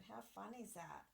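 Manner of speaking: ordinary delivery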